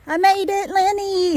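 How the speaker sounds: high, loud, joyful call; excited shout. Real, not acted. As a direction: call out happily on arrival. Arrives, calls to Lenny.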